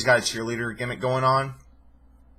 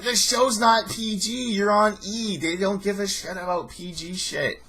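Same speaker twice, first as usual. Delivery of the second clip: exasperated protest; 9 semitones higher than usual. Real, not acted. Arguing back at the show.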